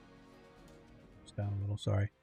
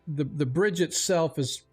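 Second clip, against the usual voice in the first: ordinary delivery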